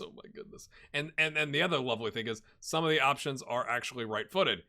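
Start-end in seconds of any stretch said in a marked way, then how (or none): 0.0-0.7 breathy whispered "Oh my goodness"; hushed amazed aside. Real, not acted. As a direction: whisper it to yourself in awe. Overwhelmed by transfer options.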